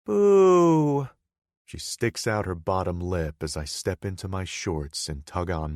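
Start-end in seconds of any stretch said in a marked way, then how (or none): 0.1-1.1 playful loud boo. Acted. Girlfriend protesting.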